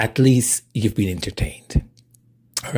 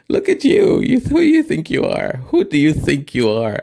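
mom's teasing voice; 7 semitones higher, 5 dB louder than usual. Retold or imitated. Mom teasing her about outfit.

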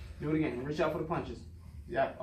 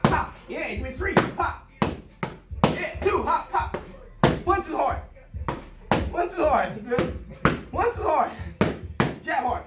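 hype coaching; 6 semitones higher than usual. Real, not acted. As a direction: fire up the fighter with every call. Coach hyping up pad work.

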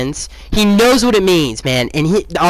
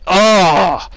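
long, slow groan of dismay; exasperated groan; much slower than usual. Real, not acted. Fan lamenting his team's defense, exasperated groan. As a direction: draw out OH in real exasperation.

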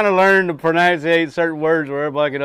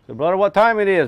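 Ocracoke brogue; 6 semitones higher, 4 dB louder than usual. Retold or imitated. Retold joke in island brogue.

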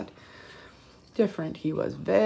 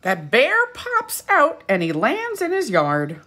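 lively storybook read-aloud; rising to a high, loud finish; reading to children; 4 semitones higher, 7 dB louder than usual. A narrator's reading. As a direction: read it with big storybook energy. Storybook narration.

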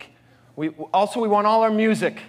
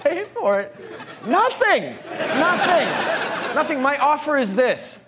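high, laughing, pinched nothing; mock indignant squeal; 7 semitones higher than usual. Comedian squeaks his offer of nothing. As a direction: squeak it out in comic disbelief. Mock answer to price question.

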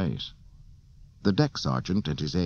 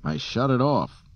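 higher, sharp retort; 6 semitones higher than usual. A narrator's reading. Han busy working on his ship, sharp reply. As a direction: answer sharply, pitched up a little. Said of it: "Han said sharply".

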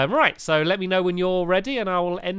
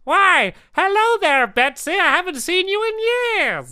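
raised-pitch playful lizard voice; gecko character voice; 13 semitones higher, 4 dB louder than usual. Acted. Gecko greeting his old friend Betsy, raised playful character voice. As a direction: play the fussy old gecko catching up.

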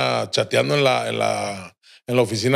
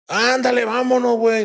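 pleading call; 10 semitones higher than usual. Retold or imitated. Dead friend insists narrator come.

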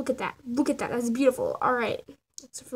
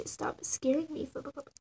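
whispered scolding; 7 dB quieter than usual. Real, not acted. Animator whispering at the app that scared her. Startled by the drawing vanishing.

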